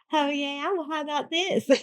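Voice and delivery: high, put-on come-on; mock flirty heroine. Podcaster imitating the heroine flirting with Callum, high and mock-seductive.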